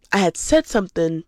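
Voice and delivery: ordinary talk, nothing marked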